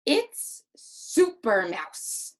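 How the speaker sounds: slow, loud excited title read; punchy, drawn-out excitement. Shown as a demonstration. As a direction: read it big and excited, word by word. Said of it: "Make our voice show what".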